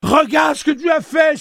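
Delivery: very high, loud shriek; crying out. A narrator's reading. Tilly seeing the stab wound, crying out shrilly. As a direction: shriek it in alarm. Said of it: "s'écria Tilly".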